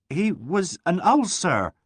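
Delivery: slow, high, forceful and flustered; flustered but energetic. A narrator's reading. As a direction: push the words out while muddled. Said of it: "she said confusedly, but with energy".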